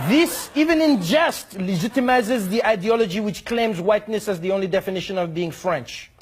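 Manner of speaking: hammed-up diplomat indignation; pompous mock ambassador. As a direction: rise up in prim outrage. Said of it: "he says".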